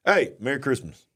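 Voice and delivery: ordinary delivery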